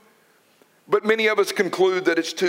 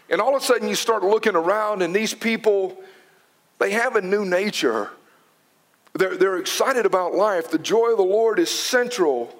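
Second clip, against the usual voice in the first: ordinary talk, nothing marked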